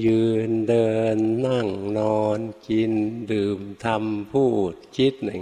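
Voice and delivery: put-on each word drawn out slowly like the old teacher; slow imitation. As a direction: spell it out word by word, unhurried. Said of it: "ท่านพูดช้าๆ นะ".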